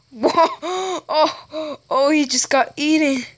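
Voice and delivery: loud laughing surprise; laughing whoa. Real, not acted. Kid laughing as another player gets eaten, laughing loudly.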